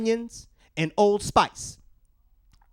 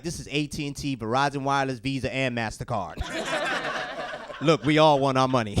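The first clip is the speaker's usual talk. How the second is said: lower, flat collector voice; bill collectors; 8 semitones lower than usual. Retold or imitated. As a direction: read it like a corporate caller. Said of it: "they like".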